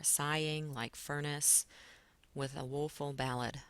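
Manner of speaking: reading to feel the beat; stressed reading. Shown as a demonstration. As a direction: tap out each beat of "woeful ballad" with the voice. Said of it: "push the air out a little bit more".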